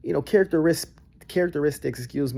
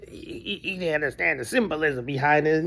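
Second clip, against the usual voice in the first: a hint of a stammering know-it-all; mock intellectual. Mocking the people who praised the halftime show. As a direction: stutter it out like a pretentious intellectual. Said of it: "people out here who want to be known as, a, like, intellectuals".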